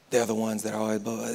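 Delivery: ordinary talk, nothing marked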